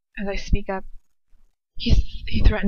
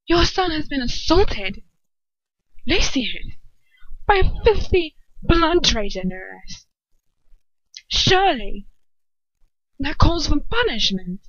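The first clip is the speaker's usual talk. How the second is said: shrill demanding Bellatrix; 7 semitones higher, 4 dB louder than usual. Acted.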